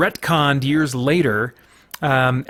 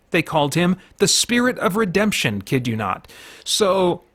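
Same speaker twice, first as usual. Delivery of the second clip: ordinary delivery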